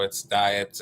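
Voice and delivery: usual speaking voice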